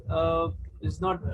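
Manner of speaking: normal talking voice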